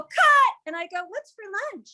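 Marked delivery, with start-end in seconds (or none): 0.0-0.5 high, loud director's call; shouted cut. Acted. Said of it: "and then go". 1.1-1.9 casual switch. Acted. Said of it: "Like that".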